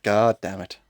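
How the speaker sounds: usual speaking voice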